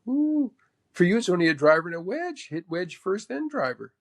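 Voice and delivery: imitated coaching with a high opening ooh. Mentor advises defensive player on clubs, imitated in a lifted voice. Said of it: "he looked over at me and goes".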